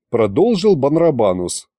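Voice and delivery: plain reading voice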